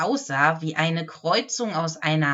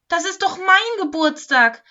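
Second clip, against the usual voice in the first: high, loud exclamation; excited calling out; 11 semitones higher, 7 dB louder than usual. A narrator's reading. Lametta tells the Wichtel it is her birthday, calling out. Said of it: "rief Lametta".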